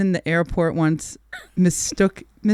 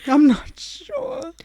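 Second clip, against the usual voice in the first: evasive daughter; 9 semitones higher, much slower than usual. Retold or imitated. Daughter's evasive answer retold.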